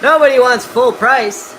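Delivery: loud, high-pitched yell; sudden full-voiced shout for emphasis. Real, not acted. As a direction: holler it over the noise of a grinder. Repeats line shouting as grinder runs.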